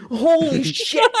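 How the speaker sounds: high, loud, stretched-out awe; hammed-up gobsmacked cheer; mock amazement. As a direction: overdo the astonishment, drawing out both words. Mock praise from the game.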